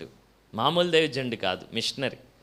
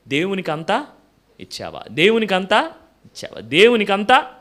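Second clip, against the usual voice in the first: building from a normal question to a loud, higher demand; insistent divine voice; 5 semitones higher, 9 dB louder than usual. Acted. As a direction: let the voice press harder each time. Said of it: "ఓ స్వరం వినబడుతుందంట".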